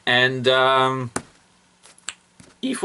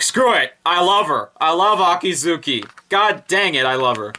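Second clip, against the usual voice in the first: excited, higher-pitched outburst of affection; giving in excitedly; 6 semitones higher than usual. Retold or imitated. Fan admits love for Akizuki, excited outburst. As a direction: throw caution away and gush. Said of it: "but I'm like".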